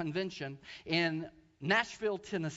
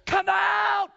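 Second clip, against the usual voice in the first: loud, high ringing command to the demon; preacher shouting at a demon; 11 semitones higher, 9 dB louder, slower than usual. Retold or imitated. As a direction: cast it out with full force. Retold casting out a demon.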